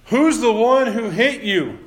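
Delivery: high, slow sneering jeer; a slow mock from the soldiers; mocking taunt. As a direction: taunt him like a cruel guard. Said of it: "They were mocking him".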